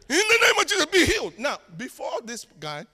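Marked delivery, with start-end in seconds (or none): 0.1-1.3 loud, high burst of healing prayer; shouted healing command. Retold or imitated. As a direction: cry out the healing like a fired-up preacher.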